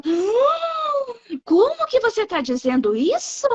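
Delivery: suspiro agudo de choque exagerado, imitando os outros; escândalo fingido. Retold or imitated. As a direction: zomba de quem se escandaliza, voz bem aguda. Imita reação escandalizada de todo mundo. (imitação exagerada de gente chocada)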